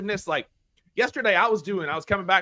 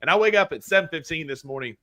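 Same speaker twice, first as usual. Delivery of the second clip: ordinary delivery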